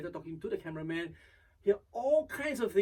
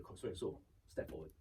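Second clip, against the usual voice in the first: low, soft echo of an instruction; subdued repeat; 9 semitones lower, 10 dB quieter than usual. Retold or imitated. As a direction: repeat it quietly, just following orders. Host obediently repeating director's instruction.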